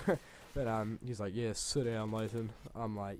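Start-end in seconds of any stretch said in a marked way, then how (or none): none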